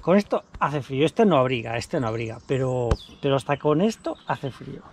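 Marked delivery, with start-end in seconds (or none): none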